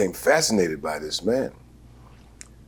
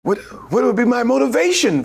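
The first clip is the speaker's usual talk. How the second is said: put-on young actor asking; 13 semitones higher, faster than usual. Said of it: "Lee Strasberg spoke of motivation".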